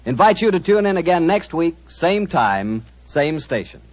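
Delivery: bright radio announcer voice; announcer read. Acted. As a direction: read the credits like a broadcast. Closing radio credits.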